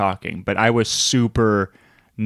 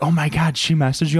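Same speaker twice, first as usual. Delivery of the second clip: higher, excited disbelief; excited gasp; 6 semitones higher than usual. Retold or imitated. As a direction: act shocked and thrilled. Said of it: "and I was like".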